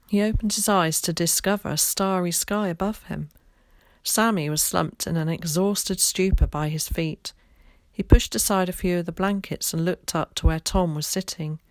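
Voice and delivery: ordinary delivery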